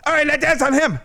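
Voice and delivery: high, pushy mock order; bossy drunk. Drunk customer ordering the stripper to dance on friends, high bossy voice. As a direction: boss her around in a high put-on voice.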